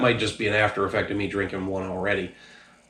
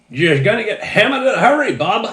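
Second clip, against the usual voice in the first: a much higher, louder, hammed-up Down East Maine accent; 11 semitones higher, 8 dB louder than usual. Reviewer nails the warning on the third try in a Down East accent. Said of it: "There we go. That might have worked".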